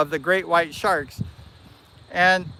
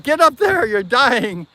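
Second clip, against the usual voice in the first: higher, louder burst, then easing slightly; mock-panicked urging, pitched up; urgent self-command; 5 semitones higher, 5 dB louder, slower than usual. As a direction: act out panicked self-talk. Acts out his panicked self-talk underwater.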